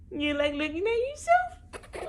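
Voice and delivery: high, sweet cooing question. Real, not acted. Cooing at baby.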